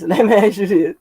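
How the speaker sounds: asked again through a laugh. Real, not acted. Teasing her friend on the phone, laughing. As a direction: chuckle while asking.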